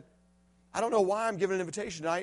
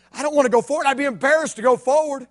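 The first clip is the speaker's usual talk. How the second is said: louder, pressing worry; anxious thought; 6 semitones higher, 9 dB louder than usual. Retold or imitated. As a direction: push the fear of going forward. Boy's embarrassed thought.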